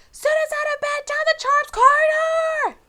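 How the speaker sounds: shrieking poltergeist raising the alarm; bellowing. A narrator's reading. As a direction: bellow the alarm as loud as you can. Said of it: "Peeves bellowed".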